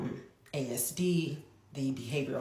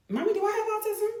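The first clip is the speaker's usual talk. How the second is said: put-on much higher, quick little boy's voice; son's voice; 6 dB louder, much faster than usual. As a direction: ask it like a small innocent boy. Mother voicing son's question.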